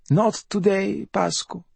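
high, self-pitying bedridden mother; high-pitched raspy invalid voice; rasping mother. A narrator's reading. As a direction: rasp it high, a mother playing at dying. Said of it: "she rasped".

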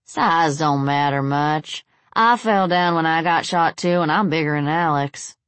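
slow Southern drawl, lightly voiced; easy drawling Southern good old boy. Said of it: "came a southern drawl".

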